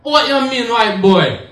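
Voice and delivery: a high, lightly put-on Jamaican accent; lightly voiced Jamaican street guy. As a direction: toss it back in a light Jamaican lilt. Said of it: "And he was like".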